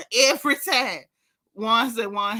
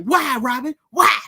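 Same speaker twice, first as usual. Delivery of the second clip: mild mock holler at her; a touch of hollering, retold; 5 dB louder than usual. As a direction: imitate an exasperated man demanding an answer. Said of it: "he hollered at her just like that".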